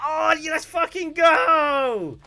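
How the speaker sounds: high, slow cheering; high hyped-up cheer. Retold or imitated. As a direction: stretch it out like a hype chant. Girls cheering retold.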